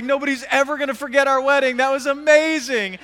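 high, loud acted-out raving; gushing enthusiasm. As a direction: rave like a thrilled newlywed. Couple raving about the wedding, acted.

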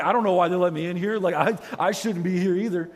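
mock tearful panic. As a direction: plead as if overwhelmed, without real crying. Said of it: "I'm gonna be crying".

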